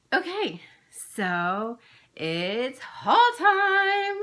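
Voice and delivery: sing-song her signature haul opener; Olivia B impression. Vlogger imitating a fellow flosstuber's sung haul intro. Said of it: "that was my Olivia B impression".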